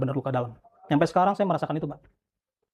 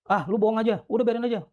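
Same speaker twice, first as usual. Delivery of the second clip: retold shout; 6 semitones higher than usual. Bystander calling the detainee a liar, retold as a high mild yell. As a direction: imitate someone calling out dismissively, pitched up. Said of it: "Ada yang teriak".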